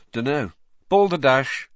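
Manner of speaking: password said firmly; Ron voice. Acted.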